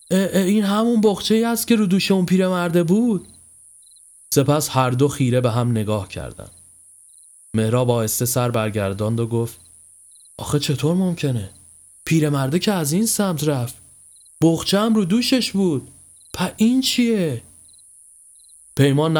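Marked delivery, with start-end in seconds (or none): 0.1-3.3 startled, high-pitched exclamation; astonished. Acted. Friend recognising the old man's bundle, astonished. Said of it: "با چشمانی از حدقه بیرون زده گفت". 10.4-17.4 higher-pitched disbelief, climbing; bewildered. Acted. Friend staring at an impossible bundle, bewildered.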